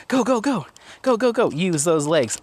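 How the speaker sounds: high, excited go-go-go; urging a dog to jump up, high; cheering on. Real, not acted. Owner cheering dog Apollo up the obstacle, high and excited.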